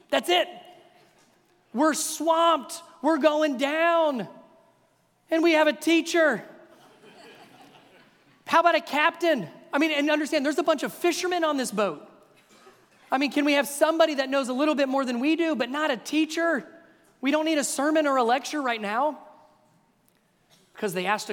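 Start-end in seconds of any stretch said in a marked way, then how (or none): 0.1-6.4 high, loud mock panic; panicked disciples. Disciples panicking on a sinking boat, high and loud. 8.4-19.2 raised, animated rant; comic exasperation. Real, not acted. Preacher joking that the disciples need a captain, animated.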